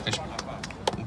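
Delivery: ordinary talk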